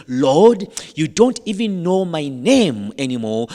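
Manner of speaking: loud imitation of an exasperated plea; frustrated prayer. Retelling her prayer to God, loudly frustrated. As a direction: cry out to God in mock frustration. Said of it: "in frustration".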